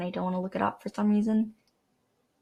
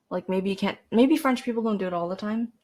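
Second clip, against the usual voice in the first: normal talking voice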